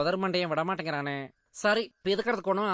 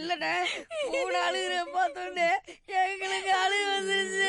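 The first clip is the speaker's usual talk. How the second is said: high wailing voice, words broken by sobs; hammed-up crying; 14 semitones higher than usual. Brothers sobbing to their elder, hammed-up wailing. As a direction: blubber it out like a big baby. Brothers cry seeing her cry.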